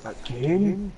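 high-pitched mock dismay; replayed cry of "not this again"; exasperated disbelief. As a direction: act out disbelief, rising on the repeat. Acting out repeated falls into cactus.

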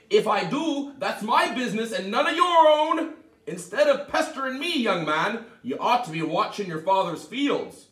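high, loud scolding; sharp leprechaun. Acted. The leprechaun scolding Tom to get rid of him, loud and high. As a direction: snap at him to go away. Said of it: "answered Tom sharply".

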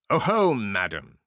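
loud, far-off triumphant ogre; gloating ogre. A narrator's reading. Ogre catching his wife's trick, loud gloating. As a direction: crow over the caught trick.

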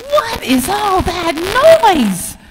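high, slow irritated complaint; annoyed donkey. Acted. Donkey complains about the quacking noise. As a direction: grumble about the racket.

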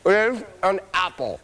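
high, upbeat game show host; booming prize announcer. Acted. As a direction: pump up the studio audience. Host runs quiz round.

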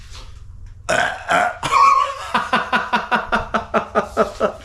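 long, high staccato cackle; drawn-out belly laugh climbing high; gleeful guffaw. Real, not acted. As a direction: laugh from ear to ear, on and on. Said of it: "Talagang yung tawa ko hanggang tenga, yung halakhak ko".

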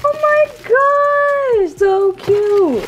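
drawn-out high squeal, loud; delighted squeal. Real, not acted. Opening a fan's handmade candle box, squealing with delight. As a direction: gush over how cute it is.

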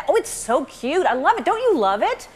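mock gushing with swooping pitch; hammed-up adoring praise, singsong swoops; overboard gushing. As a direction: imitate people overdoing the compliments. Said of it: "And then they go overboard with it".

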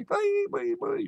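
a very high, sing-song "Bye, bye, bye."; Chinese girl voice. Retold or imitated. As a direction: squeak "Bye, bye, bye." like a cutesy girl. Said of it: "Chinese girl say".

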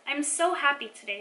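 usual speaking voice

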